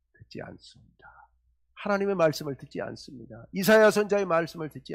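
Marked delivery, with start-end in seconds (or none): 0.1-1.3 whisper. Real, not acted. Sermon dramatic pause point.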